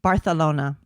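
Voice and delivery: Barcelona with a th sound, Barthelona; Barcelona with a lisp. Shown as a demonstration. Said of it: "supposed to lisp".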